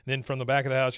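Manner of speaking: usual speaking voice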